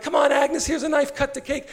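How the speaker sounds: high, chirpy coaxing women; cheery friends. Retold or imitated. Prostitutes urging Agnes to cut her birthday cake, high and cheery. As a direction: coax her along playfully. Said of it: "They're like".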